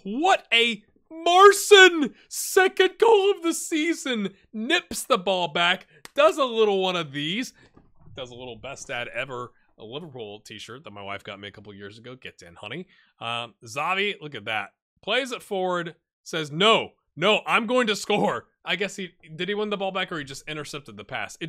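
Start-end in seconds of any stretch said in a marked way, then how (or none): 0.0-7.5 loud, high, drawn-out commentator shout; excited goal call. Real, not acted. Striker scores, streamer calls the goal. 16.5-18.5 lightly acted striker, louder and higher; determined striker. Voices the striker refusing to pass.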